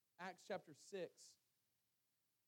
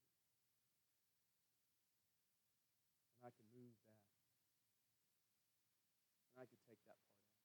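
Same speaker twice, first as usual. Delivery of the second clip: hushed, much softer admission; quiet confession; 5 semitones lower, 10 dB quieter than usual. Real, not acted. Preacher confessing his own past prejudice to congregation, hushed. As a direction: lower the voice and confess it. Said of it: "and this is confession".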